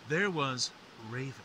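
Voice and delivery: dramatic legend narration; myth teller. A narrator's reading. Myth retold, higher and louder.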